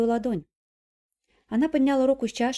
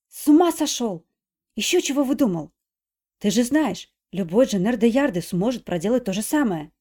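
irritated; 2 semitones higher, 6 dB louder than usual. Acted. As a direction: brush it off angrily. Nadezhda irritated retort. (раздражённо)

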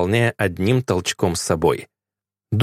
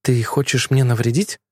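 ordinary delivery, nothing marked

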